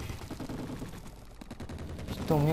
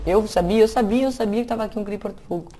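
excited i-knew-it; 7 semitones higher, 4 dB louder, faster than usual. Real, not acted. Knew a fire creeper was here.

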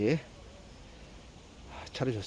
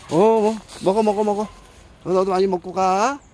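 pet talk; 8 semitones higher, 4 dB louder, faster than usual. Real, not acted. Urging cats to eat.

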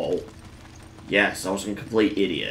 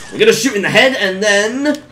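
high, loud build-up into a drawn-out "punch"; excited battle call; 11 semitones higher, 11 dB louder, slower than usual. Real, not acted. Gamer narrating his attack as he lands it, excited shout. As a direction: build up loudly to a shouted finish. Calling out a combat move.

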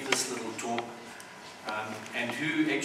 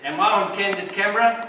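higher, louder mock disbelief; as if sure it's a hidden-camera prank; incredulous; 6 semitones higher, 8 dB louder than usual. Noah to the unseen voice, loud mock incredulity.

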